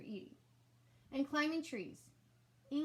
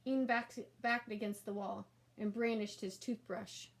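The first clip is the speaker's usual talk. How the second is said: usual speaking voice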